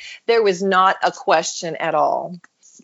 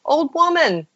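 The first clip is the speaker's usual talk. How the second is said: retold call; 7 semitones higher than usual. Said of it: "They say".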